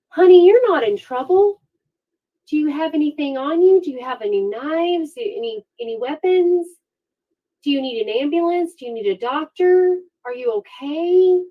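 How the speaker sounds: high, cooing mock-gentle cop voice; a hint of coddling officer, sing-song and high; mock sweet police. Police talking gently to a confessed killer, mock sweet. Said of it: "and they say".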